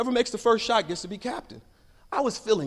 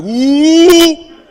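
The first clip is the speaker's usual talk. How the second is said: much louder, higher whooping cheer; class cheer; 10 semitones higher, 19 dB louder than usual. Retold or imitated. Whole class cheering the boy's reading, loud whoop. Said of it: "The whole class was like".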